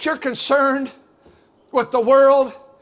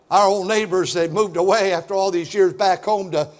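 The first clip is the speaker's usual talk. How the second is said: normal talking voice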